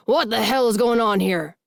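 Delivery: loud, furious father demanding answers; angry dad storming in; demanding shout. A narrator's reading. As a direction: bellow it at the room. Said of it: "His voice is so deep it makes the walls vibrate".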